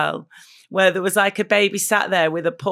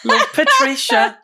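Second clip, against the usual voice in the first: high, slow, louder fond sign-off; doting elderly fan; 6 dB louder, slower than usual. Retold or imitated. Fan voiced signing a comment to Tony, high and slow. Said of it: "fan comment voiced".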